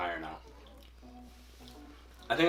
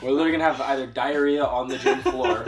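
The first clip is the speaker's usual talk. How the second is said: laughing; 4 semitones higher, 6 dB louder than usual. Real, not acted. Laughing through the protein list.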